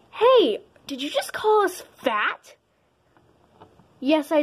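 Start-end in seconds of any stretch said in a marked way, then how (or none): 0.1-2.5 sharp high indignant call; angry shout. Acted. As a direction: call out in outrage. Insulted, confronts the bully.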